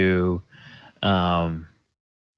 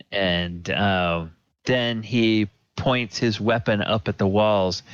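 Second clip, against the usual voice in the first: ordinary delivery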